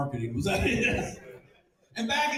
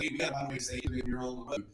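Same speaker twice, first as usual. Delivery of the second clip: quiet plea; 4 semitones lower, 7 dB quieter, faster than usual. Acted. New wife's worried plea, acted.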